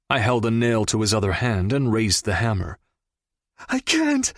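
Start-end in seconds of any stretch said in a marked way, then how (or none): 3.7-4.3 high, wailing refusal. Acted. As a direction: wail it out in despair. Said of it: "he wailed".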